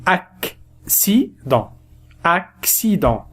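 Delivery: Accident drawn out, cc as k plus s; slow model word. Shown as a demonstration. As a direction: model Accident slowly for the class. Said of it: "الأول ينطق بالكاف والثاني ينطق بالسين".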